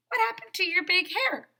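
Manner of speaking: high, fast, loud blurt; startled question. Acted. Daisy blurting a surprised question to the queen. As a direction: blurt it out in shock.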